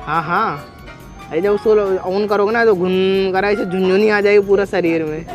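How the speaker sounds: usual speaking voice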